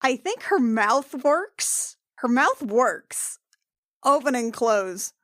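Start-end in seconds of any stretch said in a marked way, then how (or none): none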